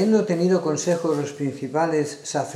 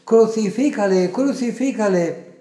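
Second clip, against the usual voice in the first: loud, higher cry, drawn out on the repeat; crowd shouting; 6 semitones higher, 6 dB louder than usual. A narrator's reading. Priests and officers on seeing Jesús, loud narrated shout. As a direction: cry out twice as the accusing mob. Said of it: "dieron voces".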